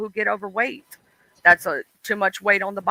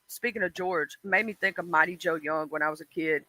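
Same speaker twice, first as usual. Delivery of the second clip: usual speaking voice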